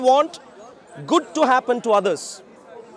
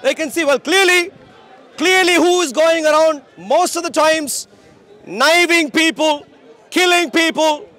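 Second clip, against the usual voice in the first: street preaching; 6 semitones higher than usual. Acted.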